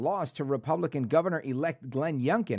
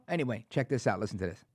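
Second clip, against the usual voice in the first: ordinary talk, nothing marked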